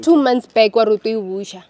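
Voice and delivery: loud, real exasperated outburst; angry confrontation. Real, not acted. Woman confronting her ex over unpaid money, angry and loud. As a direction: throw the broken promise back loudly.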